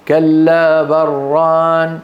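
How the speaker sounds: بل merged into ران as بران, with a doubled r; the reading with the merge, said slowly. Shown as a demonstration. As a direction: run بل into ران without stopping. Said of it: "سندغم اللام في الراء فنقول".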